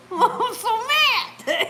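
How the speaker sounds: loud, high, drawn-out mock anger; mock fuming. Husband glaring at his wife after take-off, mock fuming. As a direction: imitate exaggerated fury, half laughing. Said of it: "My husband looked at me".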